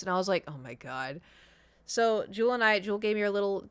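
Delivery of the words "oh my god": low, soft muttered dismay; dismayed aside. Retold or imitated. Reacting to the guys staying up gaming, dismayed. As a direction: sink the voice under the breath.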